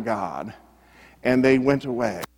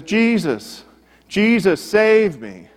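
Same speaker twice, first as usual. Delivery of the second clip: higher-pitched retold plea for rescue; calling out; 7 semitones higher, slower than usual. Man in darkness calls out to Jesus, pleading. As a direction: cry out to Jesus, in a restrained retelling. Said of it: "And he calls out".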